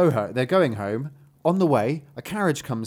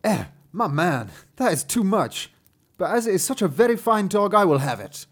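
higher, emphatic buyer's voice; haggling gentleman; 5 semitones higher, 3 dB louder than usual. Acted. Gentleman buying a fine dog from a father, emphatic haggling. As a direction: protest the price, then concede grandly.

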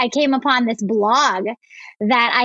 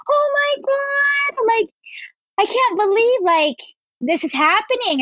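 retold tearful disbelief; 11 semitones higher than usual. Woman retells crying over her old blog, tearful high-pitched disbelief. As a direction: squeal it like happy crying, hammed-up. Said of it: "I just started crying".

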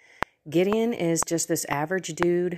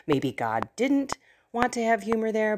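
ordinary talk, nothing marked

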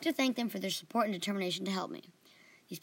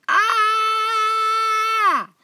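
a sudden shrieking scream, much higher and louder; falling scream; 14 dB louder than usual. A narrator's reading. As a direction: scream as the floor drops away. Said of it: "We all screamed".

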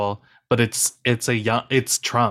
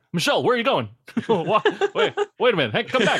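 Barack Obama impression; 7 semitones higher, faster than usual. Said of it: "I do Barack Obama".

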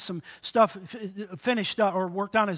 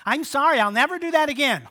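put-on teenager apology; 6 semitones higher, 5 dB louder than usual.

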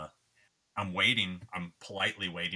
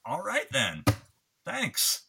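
higher, mock-polite; stung fan signing off, higher sarcasm; sarcastic thanks; 9 semitones higher, 3 dB louder, slower than usual. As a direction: thank him with a sneer. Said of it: "And I was like".

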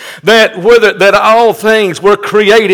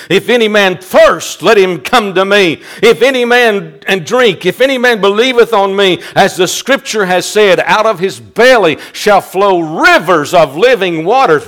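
normal talking voice

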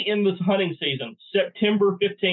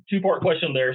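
ordinary delivery

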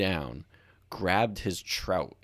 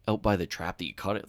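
ordinary delivery

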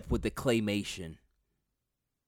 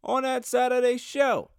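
announcer voice; 12 semitones higher than usual. Acted. Announcer voice held.

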